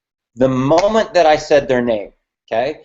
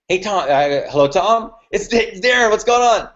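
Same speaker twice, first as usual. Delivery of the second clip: rising higher into an upbeat greeting; very high resonance; 5 semitones higher than usual. Shown as a demonstration. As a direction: climb up high as you name yourself. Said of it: "Very high resonance".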